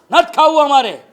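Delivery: loud, sharp refusal; angry refusal. Retold or imitated. Family member refuses the plate in anger, loud refusal. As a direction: shove the plate away with it.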